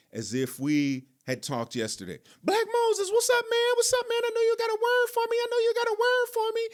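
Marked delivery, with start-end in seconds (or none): 2.4-5.4 very high-pitched, excited phone greeting impression; Terrence. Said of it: "he just had this pitch in his voice. He'd be high". 5.4-6.6 very high, fast impression of an excited friend; Terrence. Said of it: "he would always start the conversation with".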